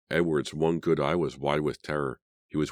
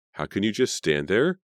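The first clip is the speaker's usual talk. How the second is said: outraged cry; 6 semitones higher, 4 dB louder than usual. Acted. Carrie outraged at men.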